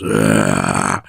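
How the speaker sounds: low guttural ugh of the noise in the dream; a deep creature groan from the dark; imitated groan. Retelling the nightmare noise as a low groan. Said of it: "the noise goes".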